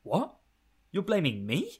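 high, incredulous questioning; mock confusion. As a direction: act wounded disbelief at being blamed. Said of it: "she's confused when somebody blames her".

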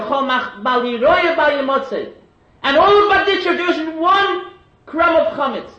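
loud, high, impassioned plea to Heaven; preaching at full force. Acted. Rebbe pleads for his people to Hashem, loud impassioned preaching. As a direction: pour it out like a rebbe arguing with God.

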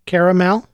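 "Caramel" with all three syllables, care-a-mel; correct pronunciation. Shown as a demonstration. Correcting people from the spelling, three-syllable pronunciation. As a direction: sound the middle a of "Caramel". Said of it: "It's obviously spelled caramel".

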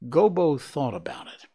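ordinary delivery

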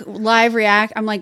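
ordinary delivery

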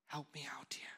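soft breathy plea to Jesus; whispered prayer. Shown as a demonstration. Preacher modelling a whispered prayer to Jesus. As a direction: pray it under your breath. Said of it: "with the whisper of faith".

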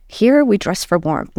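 ordinary talk, nothing marked

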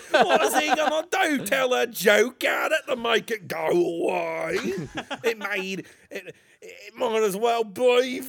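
incredulous British rant, amused. Acted. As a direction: scoff in a comic British accent. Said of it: "Can we do my British voice again?".

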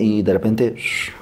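normal talking voice

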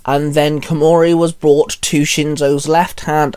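ordinary delivery, nothing marked

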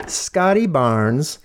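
Scotty Barnes drawn out slowly, rising at the end; slow emphatic name. Shown as a demonstration. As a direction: savor each syllable of "Scotty Barnes". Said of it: "Let me take a breath before I say this".

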